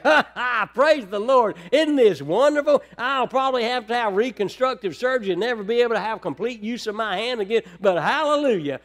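loud, high fake cheer about surgery; sarcastic mock joy. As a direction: ham up the praise the Lord. Mock joy after injury.

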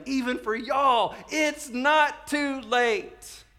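high, loud, drawn-out proclamation; slow, ringing preacher's promise; impassioned appeal. Acted. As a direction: call the good news out to the crowd. Peter's urgent appeal to the guilty.

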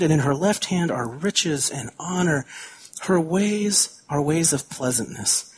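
slow, measured Bible reading; unhurried scripture reading to the congregation. Acted. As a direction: read the verses with gentle weight. Reads scripture aloud slowly.

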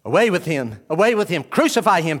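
loud repeated mob cry; crowd shouting. Acted. Crowd shouting back at Pilate, loud mob cry. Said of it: "Pilate said to them".

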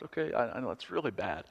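a quiet, embarrassed admission; sheepish confession. Real, not acted. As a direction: drop the voice to confess. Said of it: "Can I be honest?".

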